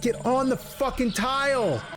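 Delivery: high-pitched exasperated command; frustrated. Real, not acted. Gamer ordering an enemy onto a tile, frustrated. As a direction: order it at the end of your patience. Fed up with moving enemies.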